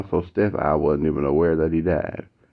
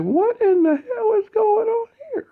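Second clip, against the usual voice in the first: alarmed outcry. Retold or imitated. Matt seeing classmates cry, very high and cracking. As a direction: voice breaks in panic. Classmates crying after teachers visit.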